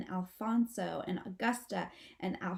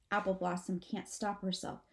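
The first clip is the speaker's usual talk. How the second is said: plain reading voice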